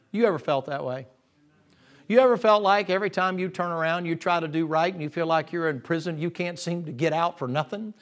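ordinary talk, nothing marked

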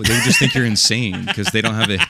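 usual speaking voice

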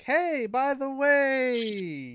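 high, hammed-up plea for help; mock clueless ask. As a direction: play the confused co-host asking for help. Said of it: "be like".

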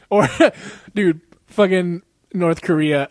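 normal talking voice

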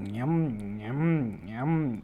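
slow, drawn-out mock chewing noises; children pretending to eat sweets, slow munching sounds. A narrator's reading. Kids faking eating sweets, slow munching. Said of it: "என்ற சத்தம் பெருங்கூப்பாடாக கிளம்பியது".

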